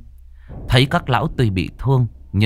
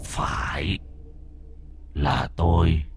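hushed menacing admission; sinister confirmation; 2 semitones lower, 7 dB quieter, slower than usual. Acted. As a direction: confirm it softly, savouring the reveal. Villain confirms his identity.

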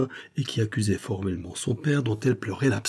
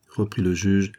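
ordinary delivery, nothing marked

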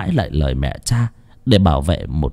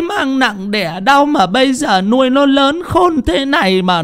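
put-on much higher wailing old woman; wailing lament. A narrator's reading. Mother wails to the village that her son scolds her, lamenting. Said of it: "bà dãy lên đành đạch".

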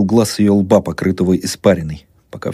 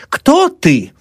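high, urgent question; frantic demand; 4 dB louder than usual. A narrator's reading. Old woman rushing at her daughter-in-law, frantic demand. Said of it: "бросилась старуха к невестке".

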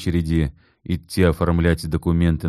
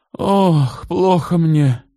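moaning; 12 semitones higher, 4 dB louder, much slower than usual. A narrator's reading. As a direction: clutch the heart and groan it. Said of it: "застонала вдруг Валентина Степановна". (старуха стонет, высоко и громко)

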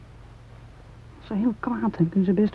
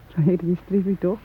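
ordinary talk

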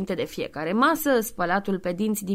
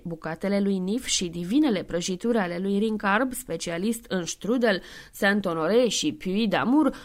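ordinary delivery, nothing marked

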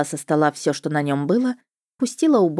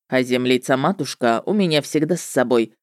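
слегка озвученный ведьмак, пониже; намёк на низкий мужской голос ведьмака; 4 semitones lower than usual. A narrator's reading. (голос ведьмака)

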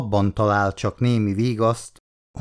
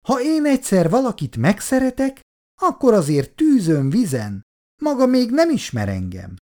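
higher, sugary voice of a chatty aunt; gushing older lady; 11 semitones higher than usual. Acted. Olgi néni flattering Bondi about his wife, gushing. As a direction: gush over his wife with sweet flattery.

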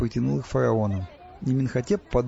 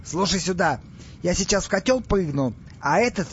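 raised, higher-pitched orders; commanding pharaoh; 6 semitones higher than usual. Acted. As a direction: lay down instructions like an irritated ruler. Irate pharaoh barking orders. (властно, приказным тоном)

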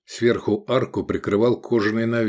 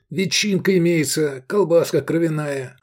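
higher, ingratiating patter; burbling innkeeper; 7 semitones higher than usual. A narrator's reading. Innkeeper listing food to guests, burbling. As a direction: purl the menu like an eager host. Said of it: "зажурчал Агатон".

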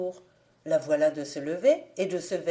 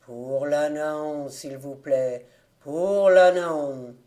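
low, slow wailing beadle's cry; drawn-out mournful plea for alms, lower; lamentable bellowing; 5 semitones lower, 4 dB louder, slower than usual. A narrator's reading. Beadle bellowing for the souls, slow and lamentable. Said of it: "bramant d'une voix lamentable".

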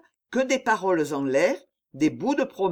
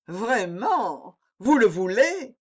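coy poet voice; 3 semitones higher, slower than usual. A narrator's reading. Poet feigning modesty before reciting.

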